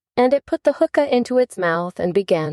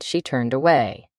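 usual speaking voice

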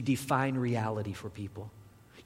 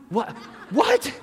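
high, loud astonished yelp; hammed-up alarm, rising sharply; shocked disbelief; 11 semitones higher, 8 dB louder than usual. Tourist reacting to an apparent confession, shocked disbelief.

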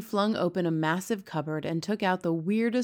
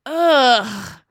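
loud, higher spluttered ugh; disgusted ugh; 5 semitones higher, 11 dB louder than usual. A narrator's reading. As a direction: spit out the taste. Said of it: "she spluttered".

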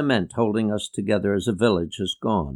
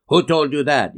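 louder, raised-pitch demand; barked question; 4 semitones higher, 5 dB louder than usual. A narrator's reading. Uncle challenging a child over sharks, barking. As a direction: bark it as a challenge. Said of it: "he barked".

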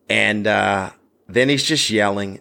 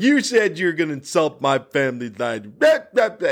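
higher-pitched mock tirade into blahs; retold rant; 5 semitones higher than usual. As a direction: imitate an offended heckler ranting on and on. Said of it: "it just kept going".

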